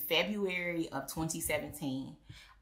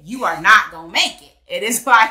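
much louder, pressing the point; loud mock-serious verdict; emphatic rant; 3 semitones higher, 14 dB louder than usual. Real, not acted. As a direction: hammer home the absurdity. Mocking social media standards.

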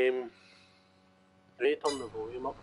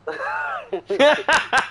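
loud, high laughing burst; real belly laugh, high and loud; 13 semitones higher, 7 dB louder than usual. Real, not acted. Caller teased about his missus, loud laugh.

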